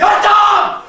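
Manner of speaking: a huge sudden shout, high and loud. A narrator's reading. The wolf springs out on the flock with a shout. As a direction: bellow it out all at once. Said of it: "et il a crié".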